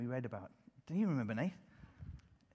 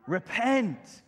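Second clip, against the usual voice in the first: loud called-out word; group call; 4 semitones higher, 7 dB louder than usual. Shown as a demonstration. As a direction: call it out for all to repeat. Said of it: "Can you say it with me?".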